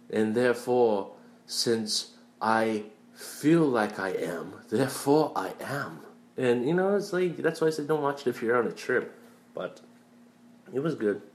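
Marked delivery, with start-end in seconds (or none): 0.1-6.0 slow, lightly voiced musing; a hint of a solemn AI monologue; philosophical anime character. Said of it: "the guy went on, like, a ten-minute tangent".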